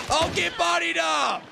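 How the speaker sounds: high, excited victory yell; gloating shout. Real, not acted. Gamer gloating over a hit, high excited shout. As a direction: holler it at the beaten opponent.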